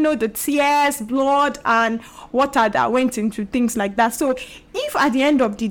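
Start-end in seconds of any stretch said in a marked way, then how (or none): none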